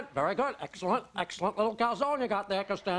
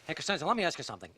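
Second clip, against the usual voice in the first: ordinary delivery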